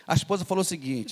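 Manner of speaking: ordinary delivery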